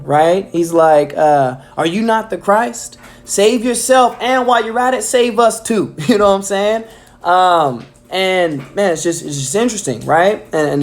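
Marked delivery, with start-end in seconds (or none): 1.8-5.9 higher, sneering mock taunt. Thief on the cross mocking Jesus, sneering higher voice. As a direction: taunt him like a jeering criminal. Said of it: "was doing it in a mocking tone".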